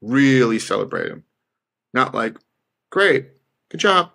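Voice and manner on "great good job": higher, lightly mocking half-hearted praise; mock hollow praise. Mocking the weak way people celebrate wins, higher and half-hearted. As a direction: parody a limp, empty pat on the back. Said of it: "Not that I sound like that".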